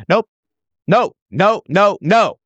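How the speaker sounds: high, loud repeated no; firm refusal. Real, not acted. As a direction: cut the thought off hard and high. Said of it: "refusing himself".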